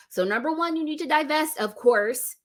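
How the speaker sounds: usual speaking voice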